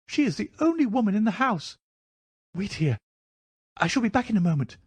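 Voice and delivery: high-pitched nervous little man; high, agitated character voice; anxious Thaddeus Sholto. Acted. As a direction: pitch it right up, fretful.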